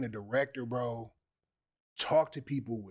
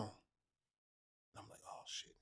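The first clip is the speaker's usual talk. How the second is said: starstruck whisper; 4 semitones lower, 19 dB quieter, slower than usual. Retold or imitated. Starstruck on seeing Omari Hardwick.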